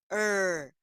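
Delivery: isolated curled-tongue ER, held; retroflex R. Shown as a demonstration. Teacher isolating a sound unknown to pupils, retroflex ER. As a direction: hold ER with the tongue bent back. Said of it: "que som é esse?".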